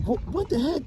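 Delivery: high, frightened yelp; trapped man seeing the dolls move, high and scared. Acted. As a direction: squeal it in sudden fright. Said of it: "he's getting scared".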